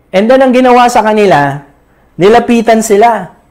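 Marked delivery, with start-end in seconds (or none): none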